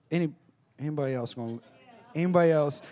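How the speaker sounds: ordinary talk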